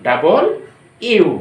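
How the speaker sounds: ডাবল ইউ broken by a pause between the words; loud ডাবল, pause, then ইউ; correct letter W. Shown as a demonstration. Teacher spelling out the correct W, separated ডাবল ইউ. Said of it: "সঠিক উচ্চারণ হবে".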